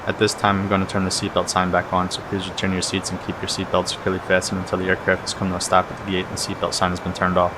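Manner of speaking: ordinary talk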